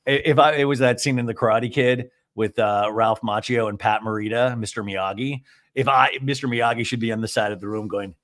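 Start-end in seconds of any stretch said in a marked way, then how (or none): none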